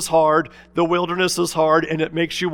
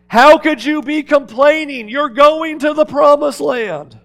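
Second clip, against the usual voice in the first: higher and louder, pleading disbelief; loud incredulous rebuke; 9 semitones higher, 8 dB louder than usual. Acted. Preacher to wandering Israel, loud incredulous rebuke. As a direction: cry it out in disbelief.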